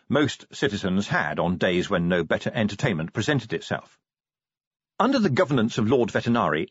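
ordinary delivery

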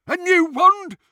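high, loud, astonished query; Travers. A narrator's reading. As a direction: pipe up loudly in surprise. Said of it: "said Travers".

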